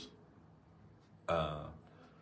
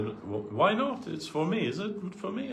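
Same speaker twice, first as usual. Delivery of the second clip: higher, coaxing elder asking to see the fax; playful insisting guru; 10 semitones higher than usual. Retold or imitated. Guru coaxing his disciple to hand over the fax, playful and higher. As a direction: press him gently, half teasing. Gurudev pressing, voiced high.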